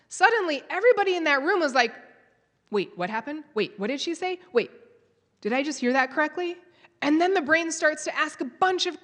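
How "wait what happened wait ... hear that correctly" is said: ordinary talk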